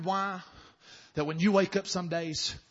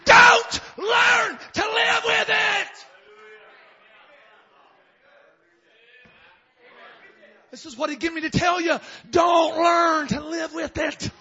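high, drawn-out full-force shout; slow, loud exhortation at the top of the voice; shouted preaching; 10 semitones higher, 9 dB louder than usual. Real, not acted. Preacher telling congregation what he was given, shouting.

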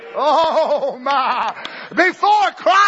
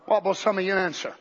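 quick aside; 8 semitones lower, 7 dB quieter, faster than usual. Real, not acted. Preacher drops to a quick aside.

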